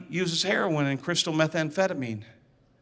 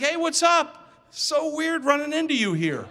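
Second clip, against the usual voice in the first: pitched way up, breezy and offhand; playing it easy; 9 semitones higher than usual. Pretending to bump into his friend, casually breezy. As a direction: fake a chance meeting. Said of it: "I tried to play it easy".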